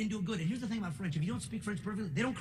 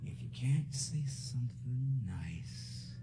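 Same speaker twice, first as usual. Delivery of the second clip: slow breathy whisper of grandma's advice; hushed grandma whisper; 7 semitones lower, 5 dB quieter, much slower than usual. Retold or imitated. Grandmother whispering advice to her grandson. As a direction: whisper it slowly like a grandmother's warning. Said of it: "my grandmother used to tell me".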